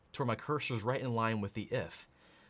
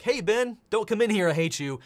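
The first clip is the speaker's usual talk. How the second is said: high, loud hey Ben; hammed-up angry rejection; mock yell; 7 semitones higher, 7 dB louder than usual. Confused bouncer shouting at Ben, mock yell. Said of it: "We're like".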